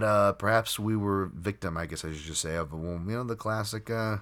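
usual speaking voice

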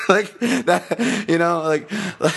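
high, loud laughter breaking through the words; giggling fit over a comedy skit memory; laughing hard. Real, not acted. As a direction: crack up and talk through it. Cracking up at the skit.

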